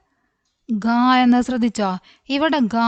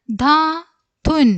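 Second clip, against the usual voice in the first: slow, higher, gap between syllables; 5 semitones higher, 4 dB louder, much slower than usual. Shown as a demonstration. Teacher introducing a word to young readers, syllable by syllable. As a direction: sound it out in two parts.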